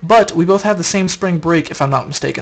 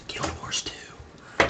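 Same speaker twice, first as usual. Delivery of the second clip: slow breathy whisper of the name; 16 dB quieter, much slower than usual. Acted. Vlogger whispering the game name to viewers, slow whisper. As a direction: hush it like a sacred secret. Excited about Guild Wars two.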